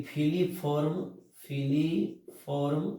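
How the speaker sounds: "filiform" drawn out syllable by syllable; slow dictation. Shown as a demonstration. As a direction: stretch out "filiform" for students to write. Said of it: "सहायक कोशिका में यहाँ पर क्या होता है". ("filiform" बहुत धीरे-धीरे बोलते हुए)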